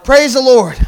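loud call to the congregation. Shown as a demonstration. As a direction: call it out for a response. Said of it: "I said, Praise the Lord".

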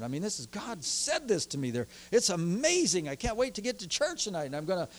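ordinary talk

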